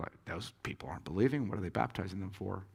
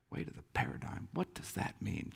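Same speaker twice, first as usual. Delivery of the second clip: half-whispered repeat, pondering; quiet puzzlement; 2 semitones lower, 8 dB quieter than usual. Retold or imitated. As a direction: murmur it to yourself, puzzled. Puzzling over the quoted phrase.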